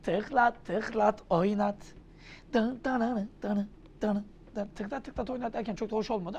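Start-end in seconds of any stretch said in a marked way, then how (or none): none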